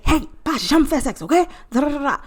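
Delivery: mock Haitian parent's quick scolding. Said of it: "And you just say".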